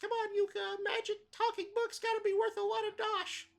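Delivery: very high, loud squawky character voice; brash cartoon sidekick.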